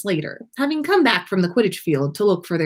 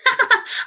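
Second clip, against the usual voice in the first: poltergeist cackle. A narrator's reading. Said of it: "started cackling again".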